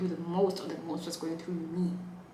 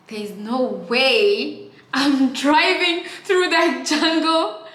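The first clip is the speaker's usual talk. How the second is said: high, slow, stretched-out wail; loud drawn-out protest over the drive; loud refusal; 8 semitones higher, 13 dB louder, slower than usual. Real, not acted. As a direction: cry out that you won't drive through the jungle.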